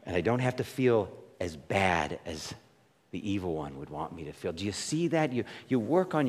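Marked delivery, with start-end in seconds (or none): 0.0-4.5 soft, low self-talk; a hushed inner reminder that sinks lower. Retold or imitated. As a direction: quietly reassure yourself. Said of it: "and say".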